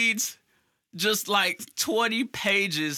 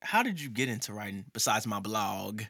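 mock deep interviewer; 11 semitones lower, 7 dB quieter than usual.

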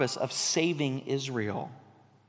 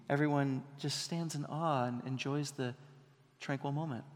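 soft mock-serene; 3 semitones lower, 8 dB quieter than usual. Mocking movie's gentle dove scene.

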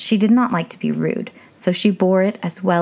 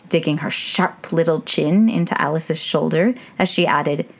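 ordinary delivery, nothing marked

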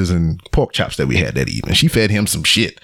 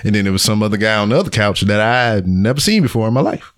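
ordinary talk

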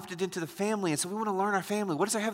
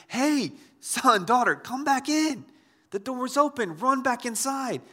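put-on higher-pitched call to the children; urgent parent calling; 6 semitones higher than usual. Parent calling son and daughter back inside, urgent and high. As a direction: call them home out of danger.

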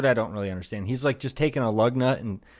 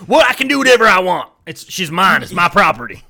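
louder, higher smug mock declaration; mock entitled guy; 5 semitones higher, 8 dB louder than usual. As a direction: ham up a smug owner, loud. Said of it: "they're just like".

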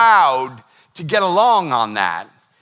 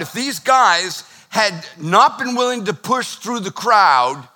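ordinary talk

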